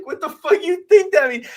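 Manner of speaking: high, loud, scoffing put-on voice; mock incredulous retort. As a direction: sneer it like you can't believe him. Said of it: "You're like".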